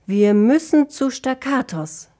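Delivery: insistent elf's exclamation; calling out. A narrator's reading. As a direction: call it out high and loud. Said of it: "rief Dissonantia".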